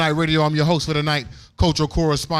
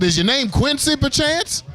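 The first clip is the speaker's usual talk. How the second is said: mock fancy question; 12 semitones higher than usual. Joking mock-posh question to DJ.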